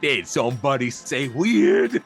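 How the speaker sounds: loud, slow booming creature voice; booming bellow. Acted. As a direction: boom it out like a big cartoon dingo. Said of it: "It's, like, loud".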